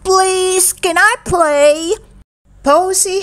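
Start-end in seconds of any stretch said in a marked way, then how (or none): 0.0-2.0 high, drawn-out plea from a little dinosaur; biggest voice. A narrator's reading. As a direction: plead slowly, pitched well up. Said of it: "in her biggest voice".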